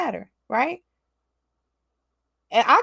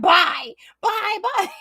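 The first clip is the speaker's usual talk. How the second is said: mock taunting bye; 4 semitones higher, slower than usual. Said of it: "just taunting her".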